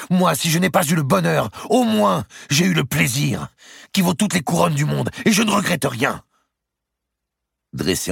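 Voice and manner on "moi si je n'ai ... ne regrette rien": very high, loud, heated comeback; defiant retort. A narrator's reading. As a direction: throw the words back at your rival. Condemned woman defies the queen.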